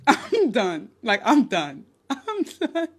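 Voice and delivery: laughing it off, giving up; breaking into laughter between words. Real, not acted. Laughing to her viewers that she is done.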